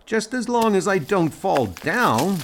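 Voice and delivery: high-pitched shout up to a friend; calling back. Acted. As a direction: call back across the rock face. Climber calls back in flashback.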